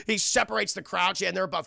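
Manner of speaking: ordinary talk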